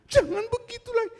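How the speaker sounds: very high, soft, prim little scold; mock squeaky scolding. Shown as a demonstration. Preacher mocking an impossibly meek angry voice, squeaky and soft. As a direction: squeak out the rebuke like a prissy mouse. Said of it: "Kita marah dengan suara".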